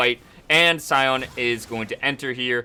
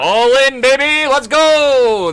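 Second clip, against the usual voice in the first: much higher, loud hype yell; hype shout; 14 semitones higher, 12 dB louder, slower than usual. Real, not acted. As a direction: shout it like a caster at the big play. Hype call as fight starts.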